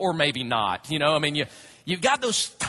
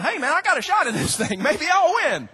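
higher, louder, laughing hope; excited golfer; 8 semitones higher, 5 dB louder than usual. Retold or imitated. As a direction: cheer yourself on with a laugh. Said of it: "Jason's going".

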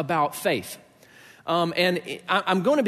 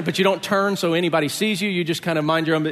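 usual speaking voice

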